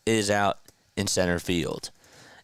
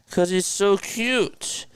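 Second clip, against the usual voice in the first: cooing; 10 semitones higher, slower than usual. Real, not acted. Cooing over the dog.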